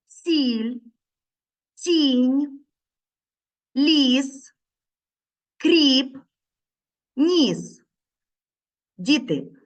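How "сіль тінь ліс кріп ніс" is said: each word slow and clear, stressing the і sound; teacher sounding out words so the sound stands out; words read for a sound. Shown as a demonstration. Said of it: "ви пізнали звук І?".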